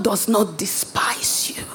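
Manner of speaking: hushed whisper, no voice at all; whispered reassurance to the congregation. Real, not acted. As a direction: breathe it to them in a whisper. Repeated line, second whispered.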